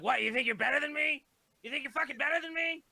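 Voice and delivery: high-pitched, worked-up retold challenge; indignant mock outburst. Homeless character confronts a passerby over change, mock indignant. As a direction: act out taking wild offence, pitch shooting up.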